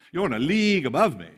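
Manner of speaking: high, loud mock awe; mocking sarcasm. [name] mocking the big shots, high loud fake awe. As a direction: gush with fake admiration. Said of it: "He's mocking them".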